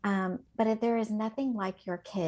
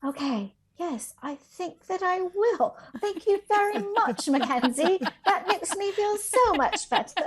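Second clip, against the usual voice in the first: hammed-up British accent, high and loud; 11 semitones higher, 5 dB louder than usual. Teacher obliging her pupil's request, playful British accent. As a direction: play up a prim British reply, breaking into laughter. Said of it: "speak with your British accent".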